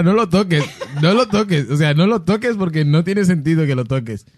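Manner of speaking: half-laughing, pleading with himself; laughing inner voice. Retold or imitated. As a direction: giggle through the warning to yourself. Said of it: "Y mi cabeza era". (voz interior entre risas)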